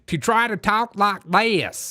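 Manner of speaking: slow, exaggerated Southern drawl; imitating a politician's fake Southern accent; mock Southern accent. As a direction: drag it out in a put-on Southern twang. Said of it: "her new Southern accent".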